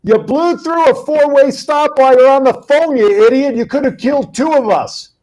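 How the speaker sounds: high, loud scolding re-enactment; retold angry yell. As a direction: act out yelling at a careless driver. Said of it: "I yelled at him".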